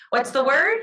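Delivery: energetic prompt to students; loud teacher cue. Shown as a demonstration. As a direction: call out the question to the room. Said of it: "Turn and tell your neighbor".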